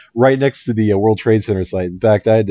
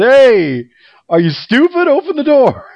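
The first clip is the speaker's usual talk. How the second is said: much higher, louder mock yell of an annoyed attendant; irritated subway attendant; 14 semitones higher, 4 dB louder than usual. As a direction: squawk it like a fed-up New Yorker. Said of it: "And then he said".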